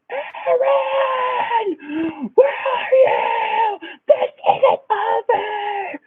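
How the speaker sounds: high, drawn-out mock scream of his name; imitated screaming. Ex storming the theater lobby, screaming for him in a slow high mock wail. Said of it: "just screaming my name".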